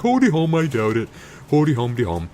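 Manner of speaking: a mocking singsong dismissal; bored singsong Leia. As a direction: hum it off like nothing matters. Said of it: "And she's like".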